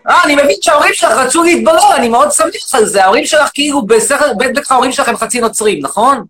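shouting, indignant rant at the audience; loud mock rant. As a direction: bellow it like an outraged demagogue. Loud persona mocks caller.

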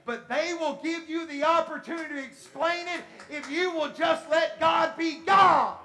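rising sermon climax; full-force preaching. Real, not acted. Preacher urging believers to let God be God, building to a shout.

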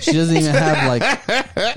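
talking through laughter; giggly and breaking off; laughing. Real, not acted. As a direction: crack up mid-sentence, voice rising. Cracking up at own remark.

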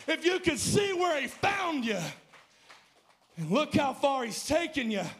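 rising, shouted preacher's climax; preaching at full force. Acted.

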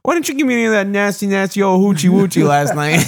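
high-pitched, playful quote of a bar patron; whiny high mock complaint to Kathy; pleading bar husband. Man retelling bar line to podcast friends, mock complaint. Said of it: "when he ca-, he's like".

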